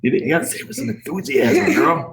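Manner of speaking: high, animated coaxing; playful urging. Shown as a demonstration. Dad coaching child on the intro, playfully urging.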